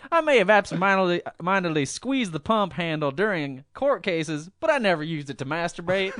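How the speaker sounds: high, loud Ross Perot impression; hammy Perot impression, fast start. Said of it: "my Ross Perot voice".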